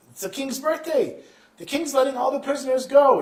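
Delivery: high, excited exclamation; elated protest. Acted. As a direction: burst out with it, pitch way up. Prisoner joyfully answers guard.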